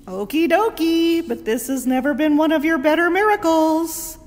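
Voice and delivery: a hint of the wry disciple; voiced Joshua. Joshua voiced in the retold story.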